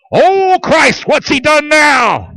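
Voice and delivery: high, exasperated mock outburst as Davis; exasperated shout. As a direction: throw up your hands at the news. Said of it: "Davis answers the guard".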